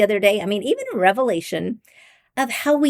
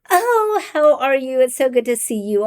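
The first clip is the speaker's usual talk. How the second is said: higher, sing-out gushing hello; octave-up greeting voice; 4 semitones higher than usual. Acted. Demonstrating how voices change when somebody comes to the office, high greeting voice. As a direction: pitch jumps up on a delighted greeting. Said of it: "your voice changes an octave".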